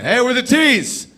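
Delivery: playing the T's, proudly; mock T's greeting. Acting out the T's group.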